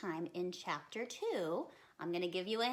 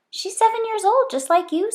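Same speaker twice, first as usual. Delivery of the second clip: higher, coaxing mom voice; mother's voice; 11 semitones higher, 20 dB louder than usual. Acted. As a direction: coax her like a patient mother. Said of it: "said her mother".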